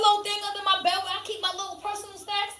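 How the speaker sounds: loud, high and heated; fired up by the memory; worked-up telling. Real, not acted. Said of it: "reliving Oreo outrage".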